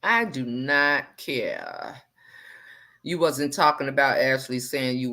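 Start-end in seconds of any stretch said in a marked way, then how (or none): 0.0-2.0 "I do not care" very slow, each word stretched; drawn-out emphasis. Real, not acted. Host shrugging off name-calling, "I do not care" slowly drawn out.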